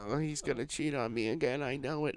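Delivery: a small, high imitation of someone crying; imitated crying wife. Host imitates the wife crying in the car, soft mock-tearful. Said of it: "she's just crying".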